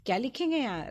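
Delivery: ordinary delivery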